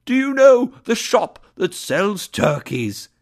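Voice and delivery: high, loud call to the street. A narrator's reading. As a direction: call down eagerly to a boy below. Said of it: "He called again to the boy".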